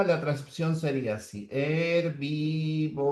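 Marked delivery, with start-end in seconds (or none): 1.5-3.1 herbívoro slowly, h silent; drawn-out herbívoro; slow dictation. Shown as a demonstration. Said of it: "la H no cuenta".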